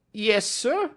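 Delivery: high, anxious little man's voice; a jittery clerk trying to sound eager; high-pitched nervous visitor. A narrator's reading. Ryder answers.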